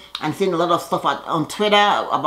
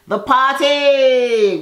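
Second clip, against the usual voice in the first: excited announcement; 7 semitones higher, 5 dB louder, much slower than usual. Real, not acted. Announces the party scene.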